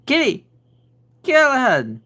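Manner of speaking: high, loud call across the room; calling the cat. Real, not acted. Owner calling her cat by name, high and loud. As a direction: call him from afar.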